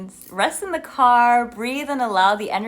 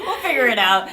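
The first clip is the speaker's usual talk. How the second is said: laughing; 3 semitones higher, 7 dB louder than usual. Real, not acted. Laughing at their own confusion.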